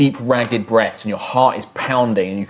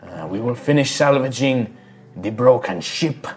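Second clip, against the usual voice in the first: tattooed shaman; 3 dB quieter than usual. Acted. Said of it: "And he's just like".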